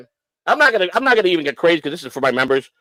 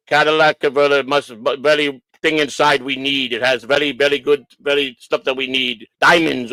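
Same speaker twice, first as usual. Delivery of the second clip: put-on halting Polish-accented English; 3 semitones lower than usual. Inspector explaining what the converter holds, Polish accent. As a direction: fumble for words like a Polish mechanic. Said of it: "he sounded Polish to me".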